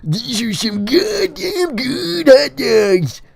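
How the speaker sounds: loud, high, drawn-out scream; hammed-up squealing character yell; Eric. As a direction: screech delight at full volume. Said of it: "then he screams out".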